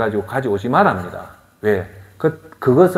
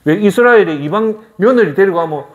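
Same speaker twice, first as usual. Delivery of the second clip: normal talking voice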